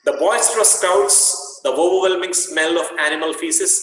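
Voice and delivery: reading a vivid passage to students; reading aloud. Acted. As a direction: paint the noisy scene as you read.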